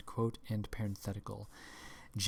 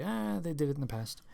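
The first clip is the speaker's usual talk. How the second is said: mocking excuse; 6 semitones higher, faster than usual. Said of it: "so long as you say".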